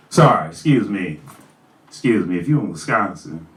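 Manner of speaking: low, winded apology; catching breath between phrases. Real, not acted. Rapper apologizing after an intense verse, winded. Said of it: "Sorry, I got winded".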